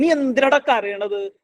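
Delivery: high, slow reproach; mock scolding question. Shown as a demonstration. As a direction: demand it like an impatient parent, as a bad example. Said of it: "എന്ന് പറയുന്നതിന് പകരം".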